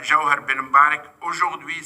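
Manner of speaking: usual speaking voice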